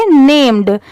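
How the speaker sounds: नेम्ड with its last d released; final d sounded. Shown as a demonstration. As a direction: say नेम्ड as "naym-d", d clearly heard. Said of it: "last में ड की sound जरूर आएगी".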